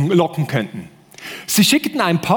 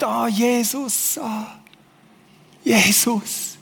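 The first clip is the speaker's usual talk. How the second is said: softer, slow, sighing devotion; mock worship sigh; 4 semitones higher, 4 dB quieter, much slower than usual. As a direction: imitate a swooning worshipper, half breathed. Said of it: "alle sind um und sagen". (gespielt andächtiges Seufzen, leiser)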